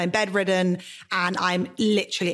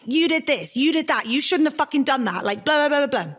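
angry retold rant; 6 semitones higher than usual. Retelling the first argument with her boyfriend, heated mock rant. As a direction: fling the accusations at him. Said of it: "like, angry, hot".

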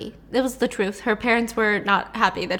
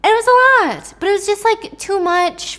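1 exclaiming; 10 semitones higher, 5 dB louder than usual. Real, not acted.

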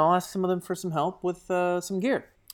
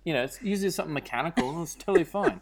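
put-on Australian accent, easygoing reassurance; 2 semitones higher than usual. Actor calming a nervous lady on a plane, Australian accent. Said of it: "slipped into my old Australian accent".

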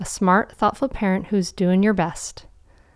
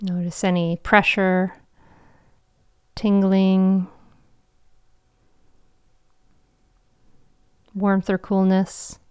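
guided meditation. Acted. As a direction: guide them gently into calm.

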